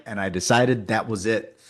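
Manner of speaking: normal talking voice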